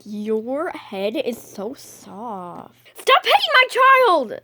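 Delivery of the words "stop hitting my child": high loud scolding yell; angry shout. Acted. Mother yelling at a passenger hitting her child, high angry shout. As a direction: protect your kid, yell it high.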